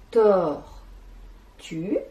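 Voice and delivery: "tortue" broken as tor... tue; teacher sounding out "tor", then "tue"; split into syllables. Shown as a demonstration. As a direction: say "tortue" syllable by syllable, long pause between. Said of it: "des syllabes qui composent".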